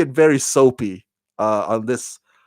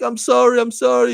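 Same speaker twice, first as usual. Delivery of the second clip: high-pitched, half-hearted tearful apology; mock crying; 10 semitones higher than usual. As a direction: imitate a crying girl pleading, without real tears. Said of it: "like, crying".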